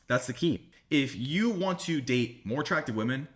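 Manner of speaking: ordinary talk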